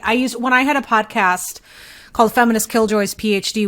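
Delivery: normal talking voice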